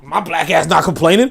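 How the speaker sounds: ordinary delivery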